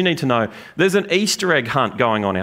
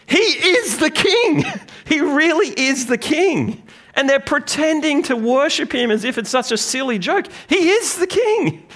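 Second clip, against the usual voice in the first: fervent exclamation; 12 semitones higher than usual. Real, not acted. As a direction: proclaim it with passion, stretching each word. Preacher's climax on irony.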